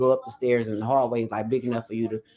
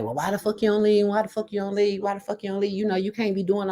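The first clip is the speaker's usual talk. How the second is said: higher, mock-incredulous asking; exasperated questioning; 7 semitones higher than usual. Outsider scolding a woman who stays, exasperated and higher. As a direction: nag her to just leave, pitched up. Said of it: "you always wanna be like".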